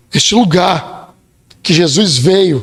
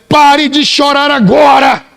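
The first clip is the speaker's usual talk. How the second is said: loud, slow, higher command; commanding shout; 5 semitones higher, 3 dB louder, slower than usual. Retold or imitated. Jesus commanding the grieving mother, loud and slow. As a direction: order her firmly to stop crying. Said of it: "E ele diz pra aquela mulher".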